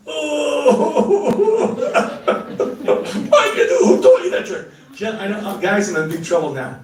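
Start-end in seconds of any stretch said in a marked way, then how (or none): 0.1-4.7 laughing outrage. Acted. Mock outrage at wife's trick.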